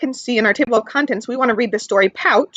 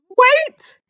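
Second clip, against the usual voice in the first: much higher little joey; Joey calling; 13 semitones higher, 3 dB louder than usual. Acted. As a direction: call out to stop the other kangaroo. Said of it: "said Joey".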